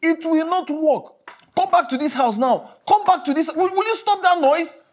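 loud, mounting fury at an absent wife; angry shouting. Acted. Furious phone message to absent wife.

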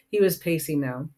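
ordinary delivery, nothing marked

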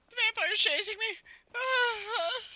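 very high, shrill fake panic; mock scream. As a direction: squeal like a terrified victim. Mock panic at being chased.

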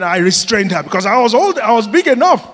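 ordinary talk

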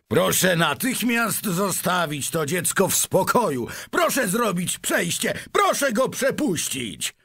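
high, commanding shout; calling out. A narrator's reading. Shopkeeper clears a way for the boy, calling out. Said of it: "zawołał gruby sprzedawca".

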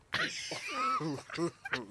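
high-pitched giggle, soft; soft high-pitched laugh. Real, not acted. Panelist laughs at his own joke.

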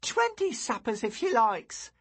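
put-on high cheerful boy; an eager boy offering. A narrator's reading. Boy Lou voiced.

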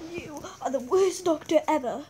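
loud furious accusation; angry shout. Acted. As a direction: yell it at the doctor. Shouting at the doctor.

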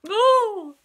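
loud, high yelp of pain; startled high "oh!"; pained cry. A narrator's reading. Elephant bitten on the leg, loud high yelp. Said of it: "bit him on the leg".

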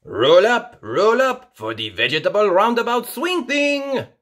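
loud, high barker's call; host inviting everyone onto the ride, loud. A narrator's reading. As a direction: roll it out like a fairground barker. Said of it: "calls Mister Potato".

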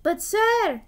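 high, loud interjection; bursting out. A narrator's reading. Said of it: "burst out Douglas".

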